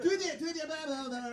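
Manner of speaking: high, loud put-on clerk voice; "Two eighty-eight" in the clerk's hammed-up accent; mock cashier voice. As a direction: rattle off the price like the clerk. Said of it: "They came out".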